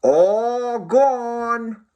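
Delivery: high, bright trumpet call. Acted. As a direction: call it out like a proud elephant. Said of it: "I trumpet".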